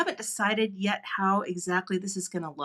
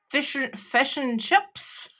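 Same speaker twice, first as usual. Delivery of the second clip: slow, raised New Zealand accent attempt; 4 semitones higher, much slower than usual. Shown as a demonstration. Testing accents for viewers, in a put-on New Zealand accent. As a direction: try it like a New Zealander, "fesh and chups". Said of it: "More New Zealander. I do know how to say".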